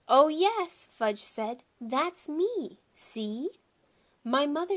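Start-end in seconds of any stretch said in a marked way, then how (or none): 0.1-0.9 put-on high, loud toddler voice; insistent little boy. A narrator's reading. Toddler insists to his mother. 1.8-3.5 lightly voiced toddler, pitched up; a hint of toddler voice; insistent little boy. A narrator's reading. Toddler insists to his mother.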